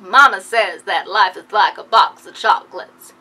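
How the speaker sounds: slow, loud drawling Forrest Gump; a hint of Forrest Gump, slow and loud; Forrest Gump quote. Vlogger quoting Forrest Gump's line to viewers, slow and loud. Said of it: "he quotes his mother and he's like".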